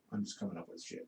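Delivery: whispered. Real, not acted. Confessing the lie aside.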